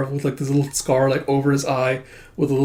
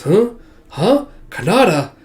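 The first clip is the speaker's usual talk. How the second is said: high, louder double take; astonished; 7 semitones higher, 5 dB louder than usual. Acted. Man recognizing the cannibal suspect's sketch, astonished. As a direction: ask it in startled disbelief.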